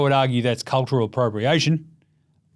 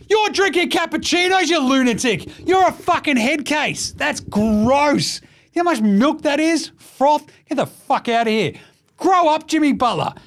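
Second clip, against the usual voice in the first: mock outrage rant; 12 semitones higher than usual. Real, not acted. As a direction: go off at him in disbelief. Ranting at Butler's coffee habit.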